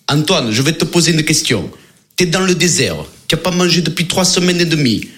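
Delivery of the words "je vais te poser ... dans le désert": ordinary delivery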